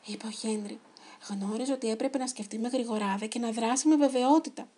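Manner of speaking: ordinary delivery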